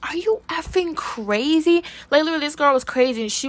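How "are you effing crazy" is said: high, slowed disbelieving jab; mock incredulity; incredulous retort. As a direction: throw it back like it's absurd. Nate's retort quoted.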